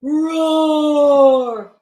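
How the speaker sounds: loud, long monster roar; Yeti roar. Acted. Yeti woken from a nap, loud roar.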